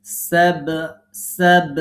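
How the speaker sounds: সাব with a loud bounced b at the end; teacher drilling সাব with a strong qalqala bounce; qalqala demo. Shown as a demonstration. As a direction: echo the b of সাব hard and loud. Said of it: "একটু কলকলা করতে হবে".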